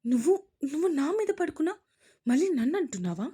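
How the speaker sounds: high, loud and fast angry protest; angry retort. A narrator's reading. Wife blaming husband lying on her, loud angry. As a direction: fire it back at him, indignant. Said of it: "కోపంగా".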